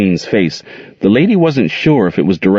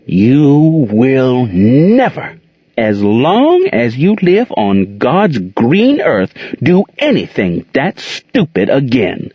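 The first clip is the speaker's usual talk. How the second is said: slow explosive anger; 4 semitones higher, slower than usual. A narrator's reading. Aunt Queen laying down the law to Gerald, slow explosive fury. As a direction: spell it out slowly, barely holding the rage. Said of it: "slowly but explosively".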